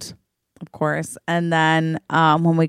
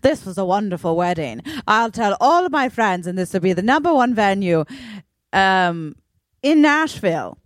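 put-on uptight Nashville woman, near-British accent; 3 semitones higher than usual. Bride's mom praising the wedding in an uptight, almost British Nashville accent. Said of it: "this uptight Nashville woman who's like".

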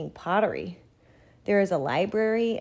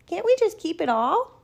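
put-on 1 pleading girl; 11 semitones higher, 5 dB louder than usual. A narrator's reading. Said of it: "I ask".

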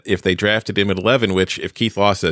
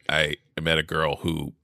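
normal talking voice

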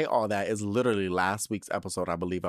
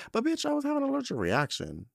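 indignant high protest; faster than usual. Real, not acted. Stressing her emergency.

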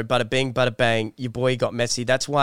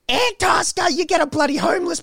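incredulous. Real, not acted. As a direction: scoff at the idea in disbelief. Scoffing at friend's app suggestion.

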